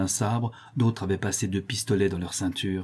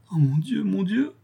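higher and higher, aghast; horrified repetition; 11 semitones higher than usual. A narrator's reading. Manon repeats her cry on hearing more, aghast. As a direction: repeat it in rising horror. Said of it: "répéta Manon".

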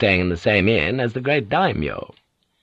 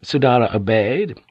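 ordinary delivery, nothing marked

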